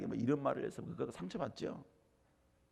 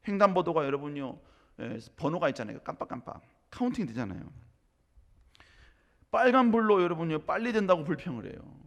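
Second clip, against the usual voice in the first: ordinary talk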